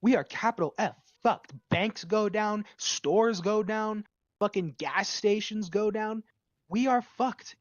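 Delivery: rising alarm, yelling the doom; building rant. Real, not acted. Ranting about Microsoft crashing everything.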